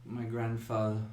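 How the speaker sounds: soft, halting murmur; quietly dazed, understated delivery; faint muttering. Acted. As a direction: let the words out in fragments, long pauses between. GM voices the muttering Amelia.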